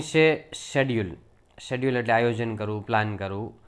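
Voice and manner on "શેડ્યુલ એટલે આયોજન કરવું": ordinary talk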